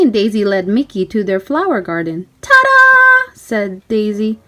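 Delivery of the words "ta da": high, loud reveal; excited ta-da. A narrator's reading. Daisy revealing the garden to Mickey, high and loud. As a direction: present it with a flourish.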